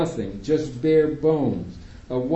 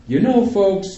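loud, high interjection; raised voice; 8 semitones higher, 6 dB louder than usual. A narrator's reading. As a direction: cut in over them loudly. Doctor interrupting parents.

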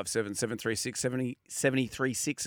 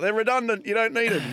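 mock critics; 9 semitones higher, 3 dB louder than usual. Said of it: "a lot of people are like".

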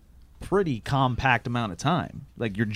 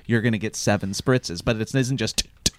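ordinary talk, nothing marked

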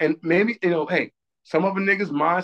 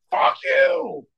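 very high, hammed-up yell; retold angry retort. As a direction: squeal it as the ex's retort. Acting out baby mom's reply.

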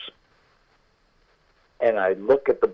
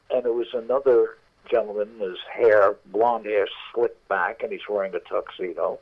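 usual speaking voice

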